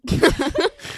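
a high, loud burst of laughter. Real, not acted. Laughing at her own joke, loudly.

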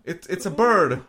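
high, loud hammed-up line; mock bird spotting; mock announcer. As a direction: shout it like a movie spectator. Skit continues.

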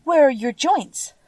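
high, urgent question; anxious. A narrator's reading. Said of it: "asked anxiously".

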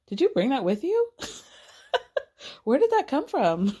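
high question after a laugh; laugh, then ask in mock bafflement; amused disbelief. Castmate asking about the mystery burger, laughing mock disbelief.

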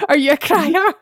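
crier rising, laughing as she asks; amused rising question on crier; said through a laugh. Shown as a demonstration. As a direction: ask it again while cracking up. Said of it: "I don't know why that sounds wrong".

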